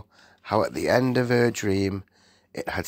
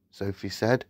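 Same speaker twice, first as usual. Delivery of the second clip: usual speaking voice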